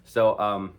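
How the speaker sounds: talking with mouth full. Real, not acted. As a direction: speak around a bite of food. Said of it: "sorry, I got the food in my mouth".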